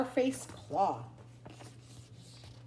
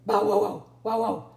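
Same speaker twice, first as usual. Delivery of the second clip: loud bow wow barks; Bitzer Maloney barking; 5 dB louder than usual. Acted. Dog barks up at the cat in the tree. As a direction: bark it like a scruffy dog. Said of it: "said Bitzer Maloney".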